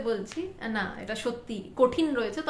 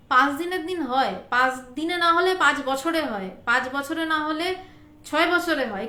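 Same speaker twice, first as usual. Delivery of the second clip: normal talking voice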